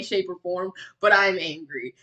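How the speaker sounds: normal talking voice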